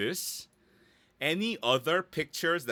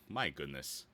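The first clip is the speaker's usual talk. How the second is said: soft, low "my goodness" under the breath; a low aside after a mistake; muttered to self; 8 semitones lower, 8 dB quieter than usual. Real, not acted. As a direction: quietly scold yourself for the slip. Self-scolding after a slip of the tongue.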